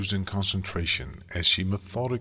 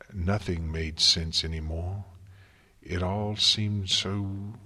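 ordinary delivery

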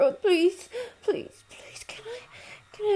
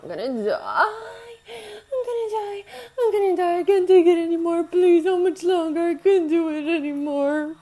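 loud crying; 6 dB louder than usual. Acted.

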